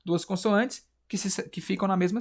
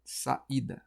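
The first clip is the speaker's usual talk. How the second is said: "Saída" soft and lower, as sa; separated syllables; 3 semitones lower, 7 dB quieter than usual. Shown as a demonstration. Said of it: "quando eu separo".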